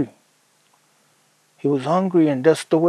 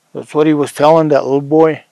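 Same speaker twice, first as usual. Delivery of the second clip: ordinary delivery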